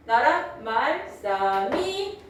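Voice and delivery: "나랏, 말, 싸미" slowly chanted in tones; 중세국어 성조 낭독. Shown as a demonstration. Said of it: "이렇게 읽어". ("나랏, 말, 싸미"를 성조 살려 읊기)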